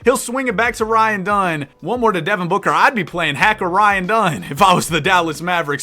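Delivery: live sports commentary, rising with the action; energetic announcer call; play-by-play. Acted. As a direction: call the game like a broadcaster. Live play-by-play of game sim.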